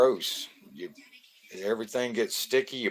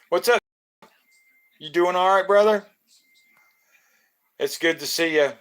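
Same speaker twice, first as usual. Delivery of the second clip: high, loud, happy hello to a buddy; light up as a friend walks in; higher and louder, welcoming a friend to the stream; hyped greeting; 7 semitones higher, 4 dB louder than usual. Real, not acted. Greeting viewer joining live.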